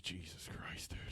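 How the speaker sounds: whispered oath under the breath; barely voiced, whisper it in dismay; whispered, retold disbelief; hushed dread. Reliving dread over lost wallet.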